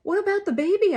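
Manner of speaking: high, loud, urgent cry; anxious cry. A narrator's reading. Midwife cries out to sheriff about baby. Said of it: "I cry".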